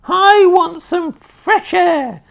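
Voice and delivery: very high, very loud screechy crone whoop; wild old woman's shout. A narrator's reading. Grandma rising through the floor, screeching with glee. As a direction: whoop with mad joy.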